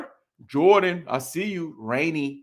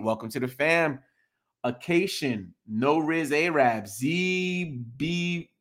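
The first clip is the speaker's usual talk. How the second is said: normal talking voice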